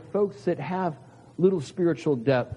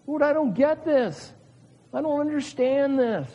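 much higher, pleading confusion; bewildered plea; 9 semitones higher than usual. Acted. Believer in crisis telling God honestly, much higher. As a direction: lay the confusion before the Lord.

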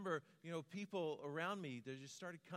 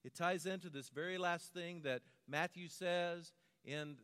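ordinary talk

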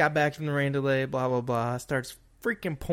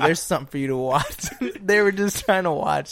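laughing; 3 semitones higher, 5 dB louder than usual. Retold or imitated. Retold, laughing loudly.

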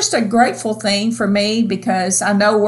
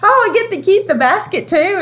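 put-on delighted old lady; 9 semitones higher than usual. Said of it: "She's like".

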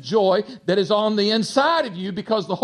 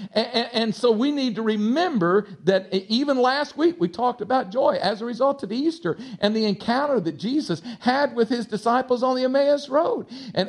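normal talking voice

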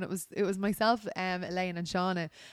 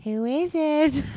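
high, slow, louder odd voice; silly voice; 6 semitones higher, 7 dB louder, much slower than usual. Retold or imitated. Answering the landlady at the door, silly voice. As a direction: drawl it out in a daft voice. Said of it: "I just went".

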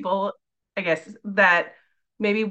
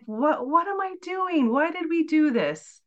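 bewildered; 7 semitones higher, slower than usual. Retold or imitated. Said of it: "I just kept going".

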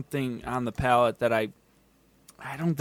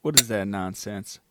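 ordinary talk

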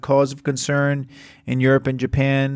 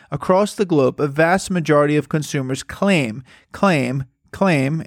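강조된 claim 반복; stress on claim. Shown as a demonstration. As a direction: hammer the word claim, repeating it. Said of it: "I don't know why I'm emphasizing that word so much".

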